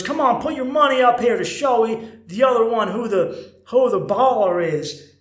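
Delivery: rowdy bar pianist egging on the crowd; hyped piano man. Retold or imitated. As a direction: work the bar like a showman. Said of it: "he goes".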